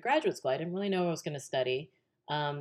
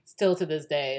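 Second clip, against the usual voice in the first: usual speaking voice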